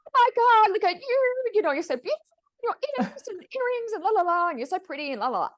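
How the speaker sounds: high, loud gushing greeting; bubbly, over-the-top compliments. Retold or imitated. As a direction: overflow with delight at her looks. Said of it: "I walked out and this lady's standing in the driveway and I went".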